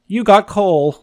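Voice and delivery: high, loud gleeful jeer; cackling taunt. Acted. Little Lottie teasing her sister over coal, cackling jeer. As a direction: crow it at your sister like a bratty kid. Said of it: "Lottie cackled".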